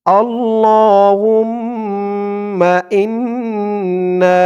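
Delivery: আল্লাহুম্মা stretched long, ইন্না held; slow teaching recitation of আল্লাহুম্মা ইন্না; drawn-out recitation. Shown as a demonstration. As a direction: draw out আল্লাহুম্মা slowly for students to copy. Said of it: "তেমন টান দিবেন".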